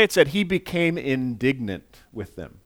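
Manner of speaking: usual speaking voice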